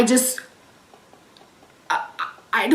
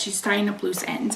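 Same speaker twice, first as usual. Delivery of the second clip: softer, stunned echo of her words; quiet disbelieving repeat; 2 semitones lower, 9 dB quieter than usual. Retold or imitated. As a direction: let it sink in, under your breath. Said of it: "Those were the exact words she said".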